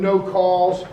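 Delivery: usual speaking voice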